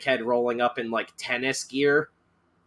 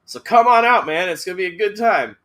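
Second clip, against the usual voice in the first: loud, high menacing taunt; madman voice; 4 semitones higher, 5 dB louder than usual. Acted. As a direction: taunt like a horror villain. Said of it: "It's like".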